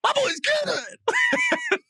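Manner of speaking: high, drawn-out proud mom cheer. Retold or imitated. Mom clapping in the stands, high proud cheer. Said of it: "She goes".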